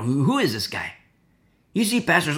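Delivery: usual speaking voice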